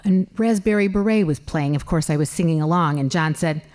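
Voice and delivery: ordinary talk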